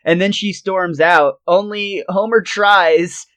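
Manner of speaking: ordinary talk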